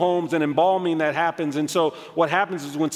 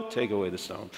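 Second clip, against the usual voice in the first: much lower, very soft; calm, quiet command; 9 semitones lower, 11 dB quieter than usual. Shown as a demonstration. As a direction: say it hushed and deeply moved. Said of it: "he says it like you all".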